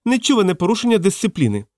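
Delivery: high, scandalised complaint; acted officious outrage; outraged fat man. A narrator's reading.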